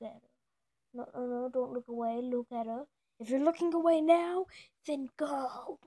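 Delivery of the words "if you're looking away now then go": playfully threatening call-out; hammed-up warning to the viewers; mock threat.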